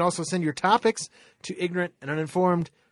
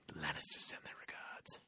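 a low, menacing whisper; 10 semitones lower, 20 dB quieter than usual. Acted. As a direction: whisper it like a threat. Quoting a Game of Thrones villain.

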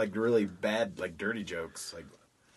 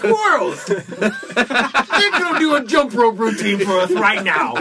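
mock shouted announcement; 13 semitones higher, 10 dB louder than usual. Comedian riffs about kids doing a jump rope routine, shouting. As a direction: holler it like an overexcited announcement.

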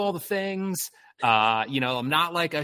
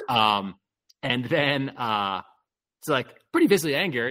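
ordinary talk

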